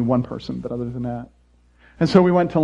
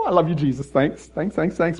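laughing mock thanks; 2 semitones higher than usual.

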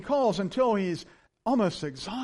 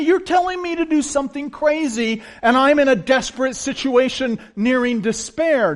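higher, louder desperate widow; widow's plea; 7 semitones higher, 9 dB louder than usual. Retold or imitated. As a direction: plead as the widow in despair. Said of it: "if I were this widow".

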